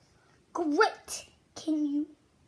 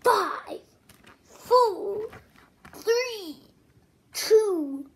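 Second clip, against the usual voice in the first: loud, higher countdown; dramatic countdown; 3 semitones higher, 6 dB louder, much slower than usual. Acted. Loud dramatic countdown, counting with the children. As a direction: count down like a launch.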